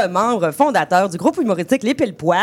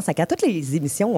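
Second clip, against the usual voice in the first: ordinary talk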